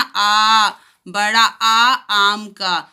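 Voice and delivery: बड़ा आ drawn out slowly; long aa. Shown as a demonstration. Teacher drawing out बड़ा आ for children. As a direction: say आ long and slow. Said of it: "जैसे हम आ को पढ़ते हैं ना".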